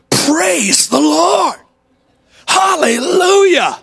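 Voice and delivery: very high, slow, ringing shout; exultant yell. Retold or imitated. The kid yells to the whole park, high, slow and loud. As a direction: let it ring out in triumph. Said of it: "the little kid even louder yelled out".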